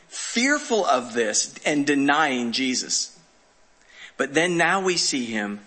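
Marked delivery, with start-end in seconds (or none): none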